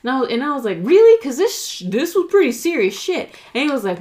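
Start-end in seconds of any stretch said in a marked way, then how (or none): 0.8-3.3 high, loud disbelief; incredulous. Retold or imitated. Telling her friend he must remember, loud and incredulous. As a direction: push back in shocked disbelief.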